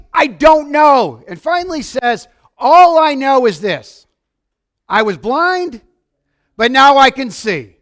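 emphatic testimony. Retold or imitated. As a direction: proclaim it slowly and loud. Blind man's testimony acted.

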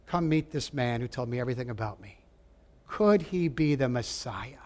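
half-whispered invitation to come and see; a hint of a woman's quiet amazement; hushed wonder. Woman calls town to meet Jesus.

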